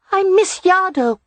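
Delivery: put-on high childish voice, complaining; a little boy whining he misses his cousin; high young boy's complaint; complaining small boy. A narrator's reading. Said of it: "Rye complained".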